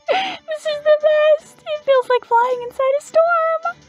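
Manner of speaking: very high, squeaky delight; thrilled sparrow fans. Acted. Sparrows tossed about by the singing, high thrilled voice. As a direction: squeal with joy in a tiny voice.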